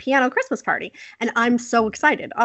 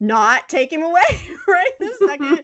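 higher-pitched pleading through laughter; amused, raised-pitch objection; laughing mock protest; 8 semitones higher, 4 dB louder than usual. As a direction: act out a stunned mom's protest while cracking up. Acts mom's protest, laughing.

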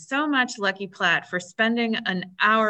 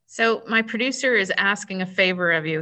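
ordinary delivery

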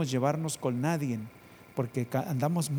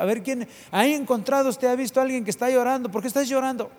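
much higher, louder, fast questions; excited questioning; 11 semitones higher, 6 dB louder than usual. Real, not acted. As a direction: fire the questions at the crowd.

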